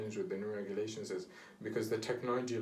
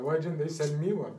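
higher, louder mock-indignant quote; mock complaint; 7 semitones higher, 7 dB louder than usual. Podcaster quoting an envious complaint to a co-host, mock-indignant. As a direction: imitate an indignant complaint, pitched up. Voices a driver's jokey complaint.

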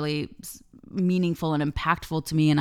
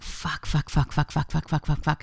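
retold panicked swearing. Retold swearing while walking it off.

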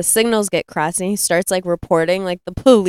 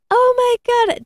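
put-on high gasping old-lady swoon; gasp, then squeal it in mock shock; mock scandalised gasp. Gaggle of old ladies reacting at Dixie's, mock scandalised gasp.

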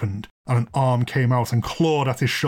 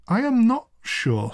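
slow, high-pitched doubt; hesitant; 10 semitones higher, slower than usual. Acted. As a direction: draw it out uncertainly. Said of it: "with some hesitation".

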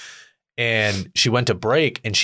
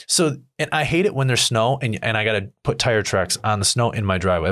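ordinary talk

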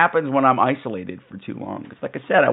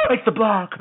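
excited gasp. Retold or imitated. Gasping at buildings.

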